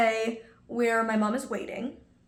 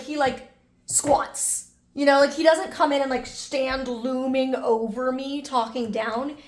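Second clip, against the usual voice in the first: ordinary talk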